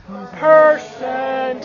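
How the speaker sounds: Percent said low and deep. Shown as a demonstration. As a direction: drop the voice down for Percent. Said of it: "Say percent in a deep voice".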